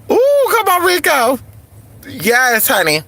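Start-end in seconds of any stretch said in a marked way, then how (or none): 0.1-1.4 high excited cheer; excited hype. Real, not acted. Hyping the song.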